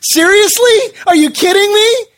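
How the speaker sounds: mock stunned protest; incredulous disbelief. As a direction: voice jumps high in shocked disbelief. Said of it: "I'm like".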